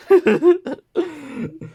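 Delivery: high, loud laugh at herself; sudden high-pitched laugh; burst of laughter. Real, not acted. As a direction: laugh out loud, embarrassed at being late. Laughs after admitting being late.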